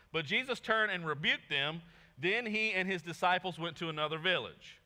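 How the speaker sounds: proclaiming the verse; scripture reading. Acted. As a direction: read it out to the congregation. Reads scripture aloud.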